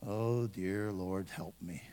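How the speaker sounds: low, slow, hushed plea to God; desperate muttered prayer. Retold or imitated. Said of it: "You're praying to the God".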